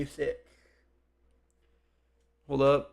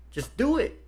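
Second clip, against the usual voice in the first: sharp high-pitched self-command; mock self-yell; 10 semitones higher than usual. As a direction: bark it at yourself, pitched way up. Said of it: "you can hear me yelling at myself mentally".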